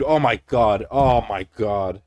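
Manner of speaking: exasperated. Real, not acted. Exasperated disbelief.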